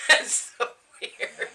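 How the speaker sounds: slow and breathy through laughter; laughing. Real, not acted. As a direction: barely get it out while laughing. Cracking up at the story.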